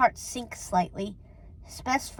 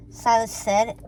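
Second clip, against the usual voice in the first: plain reading voice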